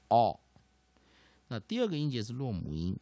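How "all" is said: "all" as a loud, rounded aw vowel; model pronunciation of all. Shown as a demonstration. Teacher showing students the variant short O, "all" as aw. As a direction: say "all" clearly with an aw sound. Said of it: "就是那样all".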